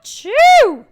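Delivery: loud high comic sneeze; big sneeze. A narrator's reading. Wolf sniffing flowers under his nose, loud sneeze. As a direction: explode into a sneeze. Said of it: "sneezed and sneezed".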